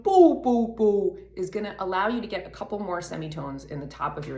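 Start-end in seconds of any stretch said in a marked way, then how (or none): 0.0-1.2 loud, dopey open-throated hoot; big open-throat dopey call; dopey sound. Shown as a demonstration. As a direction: drop the larynx and boom it out dopily. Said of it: "a dopey sound, like".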